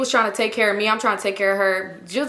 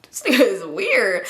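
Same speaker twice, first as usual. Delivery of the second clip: laughing it off. Retold or imitated. Mom laughing it off.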